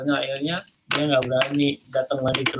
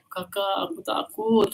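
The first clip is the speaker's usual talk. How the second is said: put-on higher, softer frightened child; scared little sister; 6 semitones higher, 3 dB quieter than usual. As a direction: whimper it like a scared kid. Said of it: "Terus adiknya bilang".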